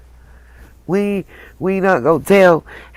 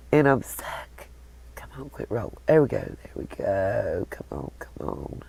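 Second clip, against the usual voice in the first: whispering; 8 semitones lower, 10 dB quieter than usual. Real, not acted. Struggling with the app, muttering.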